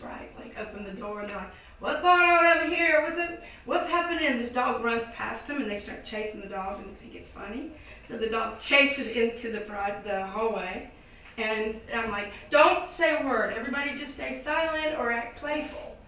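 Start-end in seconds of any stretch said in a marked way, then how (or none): 1.8-4.4 startled shout. Retold or imitated. Gangsters opening the door. 12.5-16.0 urgent command. Retold or imitated. Commanding the group in the hallway.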